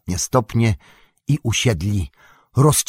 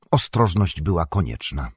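ordinary talk

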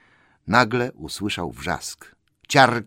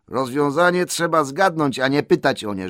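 raised, higher-pitched correction; firm rebuke; 6 semitones higher, 4 dB louder than usual. Acted. Bilbo corrects Gollum about riddles, firm raised voice. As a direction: lay down the rule, insistently.

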